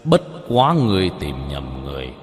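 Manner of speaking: low, ominous villain voice; deep voice. A narrator's reading. Man on stage denies being the ghost master, deep cold voice. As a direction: drop into a deep, measured register. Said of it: "trầm giọng hỏi".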